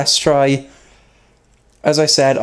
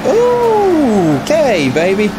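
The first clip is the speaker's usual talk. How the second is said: surprised high ooh; 11 semitones higher, much slower than usual. Real, not acted. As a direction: react to being bumped from behind. Car nudged from behind.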